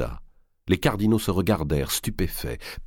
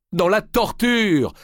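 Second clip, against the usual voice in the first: «Dans la torture!» lancé très haut, fort et lent; réplique puissante; 4 dB louder, slower than usual. A narrator's reading. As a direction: marteler «torture» avec force. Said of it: "répliqua Molay". (Molay réplique à Marigny, voix tonnante et lente)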